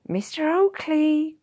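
high, loud, drawn-out greeting; surprised cry. A narrator's reading. Villager hailing a neighbour from a window, surprised cry. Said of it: "cried the brawny".

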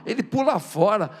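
ordinary talk, nothing marked

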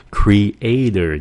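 creator pulled apart as cre-A-tor; three drawn-out syllables in "creator"; syllables separated. Shown as a demonstration. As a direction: say "creator" slowly, splitting cre and ator. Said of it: "tách nó ra".

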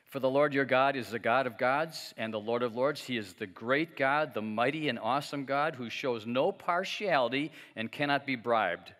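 booming preacher reading; scripture reading. Acted. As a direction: declaim the verse to the hall. Reads scripture aloud.

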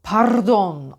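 "Pardon" with a long, heavy trill; exaggerated trilled "Pardon"; overpressed rolled R. Shown as a demonstration. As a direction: roll the R in "Pardon" too hard. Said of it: "Çok baskılı söylemek de yanlış".

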